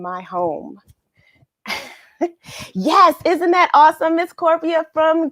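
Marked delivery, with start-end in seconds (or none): none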